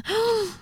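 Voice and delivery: high sudden gasp of pleasure; sharp gasp. Acted. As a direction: catch the breath sharply.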